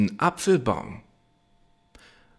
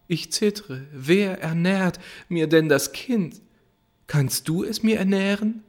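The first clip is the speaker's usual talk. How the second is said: put-on higher girlish voice, complaining; reproachful girl; 6 semitones higher than usual. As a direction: plead as the worried maiden. Hanne reproaches Johann. (höhere, vorwurfsvolle Mädchenstimme)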